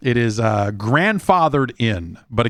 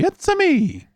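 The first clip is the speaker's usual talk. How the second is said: Mario impression. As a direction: pop it up high like the cartoon plumber. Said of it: "one of them's gonna be".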